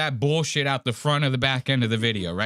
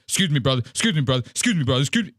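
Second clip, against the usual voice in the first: repetitive mock-urgent pushing through; imitated agitation; much faster than usual. Vlogger pushing through the crowd, mock agitated. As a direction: lightly mimic someone agitated, each plea the same. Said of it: "he's all agitated. He's like".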